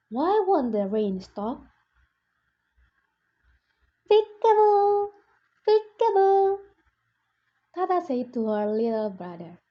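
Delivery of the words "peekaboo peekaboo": high, bright sing-song peekaboo; playful peekaboo. Acted. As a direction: play peekaboo with a baby. Big sister playing peekaboo with baby.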